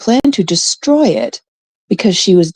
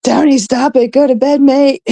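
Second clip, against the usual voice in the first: higher, playful quoted telling-off; relayed mock scolding; 6 semitones higher than usual. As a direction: pass on a friend's scolding, pitched up. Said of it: "Carrie says".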